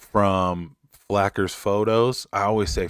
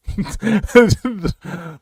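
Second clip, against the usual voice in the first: real high, louder cackling laugh; high giggle; 6 dB louder than usual. Real, not acted. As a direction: crack up at your own joke. Laughs at own retort.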